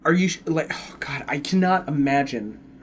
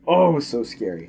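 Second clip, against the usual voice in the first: ordinary delivery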